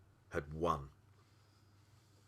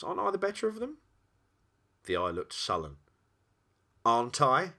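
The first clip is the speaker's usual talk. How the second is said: challenging; 5 semitones higher, 4 dB louder than usual. Acted. Polo presses the demon to concede.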